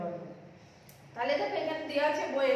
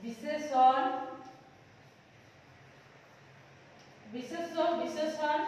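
dictation; slower than usual. Shown as a demonstration. Teacher dictates the five parts.

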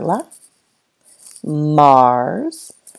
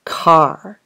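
usual speaking voice

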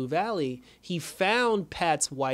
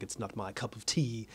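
much lower, softer villain voice; the Shade refusing; 4 semitones lower, 9 dB quieter than usual. Retold or imitated. The Shade refusing Pat's advice to fight, low and hushed. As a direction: murmur it low and dismissive. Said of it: "He was like".

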